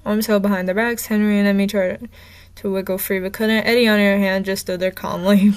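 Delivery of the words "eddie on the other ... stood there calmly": usual speaking voice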